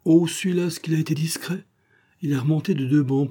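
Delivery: put-on young boy reporting. A narrator's reading. Gamin reporting back to Fouinard, light boyish voice. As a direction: lift the voice into a boy's.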